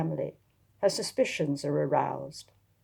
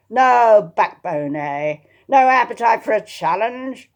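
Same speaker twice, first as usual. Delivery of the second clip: louder, slow mocking jeer; taunting; 5 semitones higher, 10 dB louder than usual. Lady Wem taunts Attilia as she leaves. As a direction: goad her like a bully. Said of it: "The woman's taunting voice followed her".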